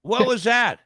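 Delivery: loud, high shocked question; startled outcry. Retold or imitated. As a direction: shout it out in alarm. Startled by yank on leg.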